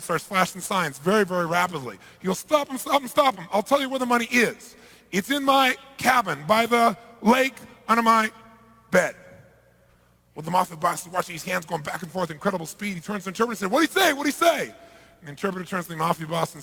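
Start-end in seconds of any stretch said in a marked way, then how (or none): 2.4-9.2 high, louder frantic confession; panicked pleading. Acted. Accountant at gunpoint revealing where the money is, panicked and high. 13.7-14.7 high, loud impatient question; urgent demand. Acted. Mafia boss demanding a translation from the interpreter, loud, high and urgent.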